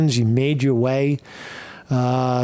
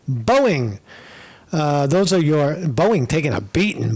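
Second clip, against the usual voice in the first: ordinary talk, nothing marked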